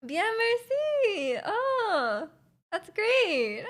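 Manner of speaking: high, drawn-out swooping reply; sing-song, stretched-out pleasantries; mock small talk. Plays out French small talk.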